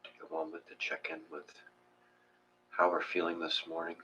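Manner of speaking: soft, hushed meditation voice with long pauses; guided meditation. Acted. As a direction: ease them into the breath, quietly.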